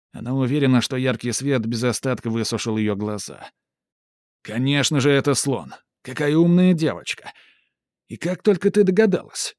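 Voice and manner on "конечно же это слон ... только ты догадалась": high, loud mock praise; showman praise. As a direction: gush like a ringmaster. Сарацин хвалит девочку. (восторженный конферансье)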